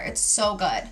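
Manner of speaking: usual speaking voice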